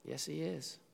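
quietly sure, almost whispered; soft assurance. Real, not acted. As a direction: answer gently. Quiet rebuttal.

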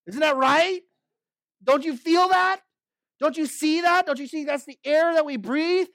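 high, insistent rhetorical questions; urgent preacher's appeal; fervent appeal. Real, not acted. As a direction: press the listeners to agree.

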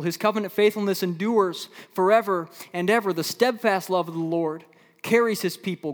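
high, loud, fervent preaching; full-force preaching. Acted. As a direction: drive it home to the congregation.

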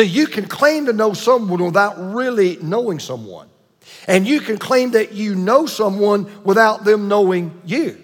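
usual speaking voice

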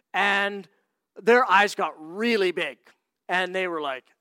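ordinary delivery

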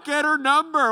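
loud, high teasing shout; friend's heckle. Retold or imitated. Same buddy heckling him again, high and loud. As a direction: call it out to embarrass him.

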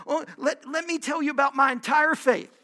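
ordinary delivery

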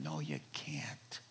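hushed, unvoiced no, slow; whispered aside. Real, not acted. Preacher whispering to his Hebrew friend, hushed aside. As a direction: drop to a knowing whisper.

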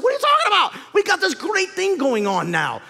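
loud, high-pitched exclamation as exasperated disciples; incredulous protest. Acted. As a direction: cry out in disbelief, voice way up, then stretch it out. Disciples protesting Jesus's plan to leave.

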